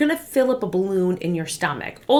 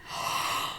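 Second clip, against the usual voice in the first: audible breath through mouth; open mouth breath in; 9 dB quieter than usual. Shown as a demonstration. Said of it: "Breathing in through your mouth".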